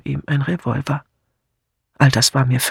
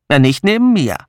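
put-on louder, curt older woman's reply; dry retort; 4 dB louder than usual. A narrator's reading. Miss Hinchliff correcting her friend, dry and curt. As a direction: cut her off dryly.

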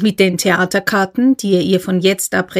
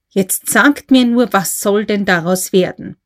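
ordinary delivery

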